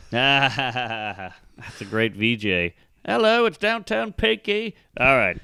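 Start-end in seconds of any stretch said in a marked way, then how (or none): 3.0-4.7 higher, bright-on-air DJ intro; radio DJ voice. Pretend. Comic playing a silly radio host, mock DJ greeting. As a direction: announce yourself like a cheesy morning DJ.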